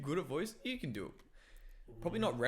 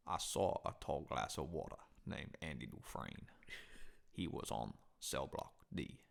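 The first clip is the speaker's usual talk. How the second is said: lightly lowered Shawshank narrator voice; Morgan Freeman narration; 9 semitones lower, 6 dB quieter than usual. Retold or imitated. Launching into a full Shawshank scene as Morgan Freeman, low. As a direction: narrate it low like the prison movie. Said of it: "went into fucking his movie".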